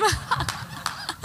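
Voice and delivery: high, breaking nervous laugh. Real, not acted. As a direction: laugh out the nerves, voice cracking. Nervous at the pulpit.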